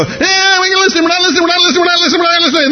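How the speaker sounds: very high, frantic wail drowning someone out; mock mob outcry. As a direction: shriek over him in a mock tantrum, refusing to hear. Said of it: "they cried out with a loud voice".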